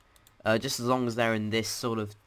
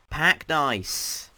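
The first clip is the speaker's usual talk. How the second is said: higher, louder title call; announcer call; 5 semitones higher, 5 dB louder than usual. Real, not acted. Host naming the next dimension for viewers, raised loud announcement. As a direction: call it out like a heading.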